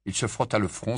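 usual speaking voice

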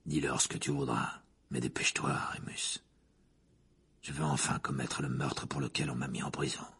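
low, menacing man's voice. A narrator's reading. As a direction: keep it deep and dark.

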